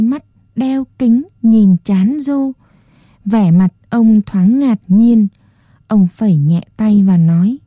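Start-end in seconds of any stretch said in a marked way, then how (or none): none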